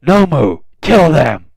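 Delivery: mock yell at the Nomu to kill; hammed-up angry villain shout; villain's command. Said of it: "He's like".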